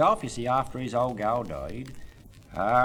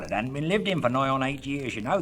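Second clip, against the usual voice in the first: ordinary talk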